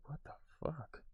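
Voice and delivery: very soft mock-shocked whisper; whispered disbelief. Vlogger reacting to vet medicine prices, stunned whisper. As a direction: whisper it under your breath, stunned. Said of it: "I'm just like".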